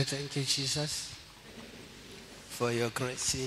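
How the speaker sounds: ordinary talk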